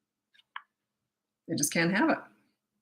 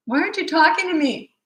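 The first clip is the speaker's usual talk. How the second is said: put-on high, wounded child's plea; hammed-up kid's wail; mock whining child; 11 semitones higher, 4 dB louder than usual. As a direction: imitate a four-year-old demanding attention. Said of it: "In other words, "Oh, I'm in pain here".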